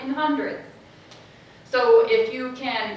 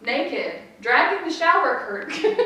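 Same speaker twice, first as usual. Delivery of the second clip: usual speaking voice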